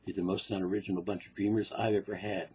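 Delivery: low, soft, sour psychiatrist muttering; a bored analyst sneering under his breath; low jaded shrink grumbling to himself; grumpy doctor. Acted. Doctor scolding patient.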